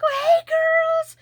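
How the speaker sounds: very high, slow, delighted greeting; excited greeting. Retold or imitated. Greeting the neighbour's dogs, high and delighted. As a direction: sing it out to dogs you missed. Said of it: "I'm like".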